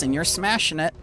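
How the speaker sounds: ordinary delivery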